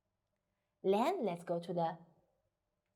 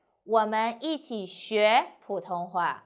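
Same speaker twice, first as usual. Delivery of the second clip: 学 punched louder in the sentence; stress on 学; 5 dB louder than usual. Shown as a demonstration. Teacher showing no special idea, stress on the verb 学. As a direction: lean on the verb 学. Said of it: "you need to stress the verb 学".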